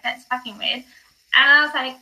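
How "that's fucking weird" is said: puzzled mutter. Retold or imitated.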